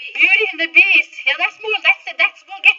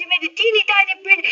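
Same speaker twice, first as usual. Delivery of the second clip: usual speaking voice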